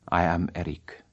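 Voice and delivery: a hint of Eric, low and hushed; Eric's voice. Eric revealing his name to Christine, low and hushed.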